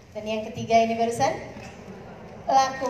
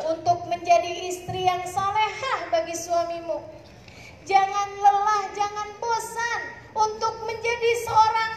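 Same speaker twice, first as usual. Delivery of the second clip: impassioned preaching; 6 semitones higher than usual. Acted. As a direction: urge the congregation on in a rousing refrain. Impassioned exhortation to the audience. (ceramah membara, nada tinggi mendayu)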